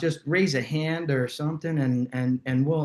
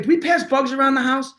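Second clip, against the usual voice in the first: retold question; 10 semitones higher, 3 dB louder than usual. Becca asks dad on speakerphone.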